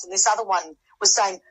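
normal talking voice

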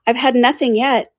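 touch of indignant protest; offended. Acted. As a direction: object with hurt dignity. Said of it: "replied in an offended tone".

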